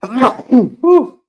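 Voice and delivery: loud cough, then a breathy whew; coughing fit. Real, not acted. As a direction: cough hard, then blow out. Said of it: "Excuse me".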